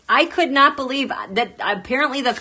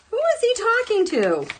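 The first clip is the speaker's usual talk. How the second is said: incredulous question; 12 semitones higher than usual. Real, not acted. Refrain in high voice.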